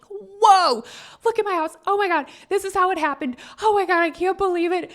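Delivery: high, loud, fast excited gush; reliving the thrill of the new house, high excited gush; ecstatic gushing. Retold or imitated. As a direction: gush like you just saw your dream house. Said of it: "I was like".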